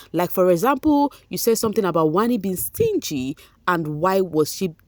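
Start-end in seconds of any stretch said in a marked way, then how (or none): none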